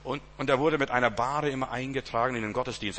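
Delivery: usual speaking voice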